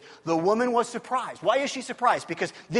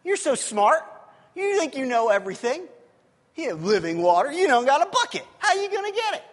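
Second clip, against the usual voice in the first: loud, sarcastic taunt rising to high indignation; mock him with mouthy sass; copping an attitude; 4 semitones higher, 5 dB louder than usual. Woman at the well challenging Jesus's living water, sassy. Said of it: "that's what we're seeing here".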